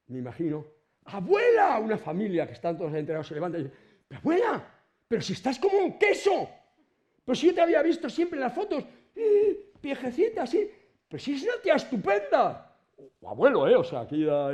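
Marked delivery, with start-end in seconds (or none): 1.0-1.8 excited call. Acted. Grandchild greets risen grandmother. 4.1-12.8 high, loud, delighted grandchild voice; amazed grandchild. Acted. Grandchild at the resurrection gushing over grandmother, high and loud.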